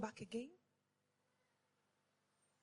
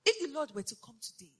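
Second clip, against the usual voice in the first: ordinary delivery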